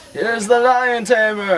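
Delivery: high, loud booming circus announcer; ringmaster announcing. Acted. Ringmaster to circus crowd, booming high announcer call. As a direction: sell the act to the whole tent.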